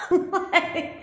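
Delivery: high, laughed-out word; real laughing. Real, not acted. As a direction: crack up while trying to go on. Cracking up at batshit crazy quip.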